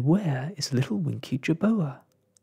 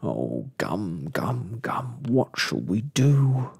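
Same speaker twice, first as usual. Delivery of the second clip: wailing groan, swelling at the end; storybook despair; 2 semitones lower, 3 dB louder, slower than usual. A narrator's reading. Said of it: "groaned Mister Lizard".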